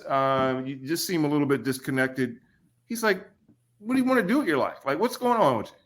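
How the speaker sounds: mild impression of the coach asking; imitating head coach. Head coach talks to player.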